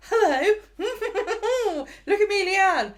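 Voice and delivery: high, loud, smug preening; mock her smug self-satisfaction. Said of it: "she's just so smug".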